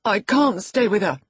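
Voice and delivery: put-on high, loud petulant boy; cross. A narrator's reading. Boy answers the housekeeper's scolding, cross. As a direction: snap back crossly as a sulky child. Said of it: "he answered crossly".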